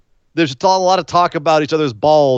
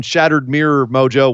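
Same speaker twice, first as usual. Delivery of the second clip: ordinary delivery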